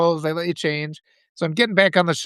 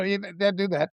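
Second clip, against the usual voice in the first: normal talking voice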